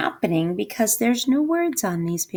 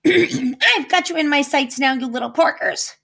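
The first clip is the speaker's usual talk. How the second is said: higher, gloating witch; cackling witch; 7 semitones higher, 4 dB louder than usual. Acted. Witch gloating at piggies from her broomstick, lightly cackling. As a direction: lightly cackle it as you swoop. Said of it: "she cackled".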